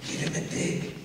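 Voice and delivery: whispered urgent hint from the wings; stage whisper. Retold or imitated. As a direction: hiss it so the audience can't hear. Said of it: "she's going".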